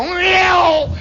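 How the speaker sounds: high, loud plane yowl; airplane take-off noise. Retold or imitated. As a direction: howl like an engine jumping off the ground. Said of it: "they would go".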